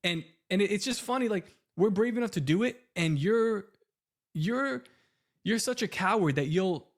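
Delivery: high-pitched, worked-up scolding; riled-up telling-off of a rival; heated rant. Real, not acted. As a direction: let the real indignation push your pitch way up. Heated rant continues, calls him coward.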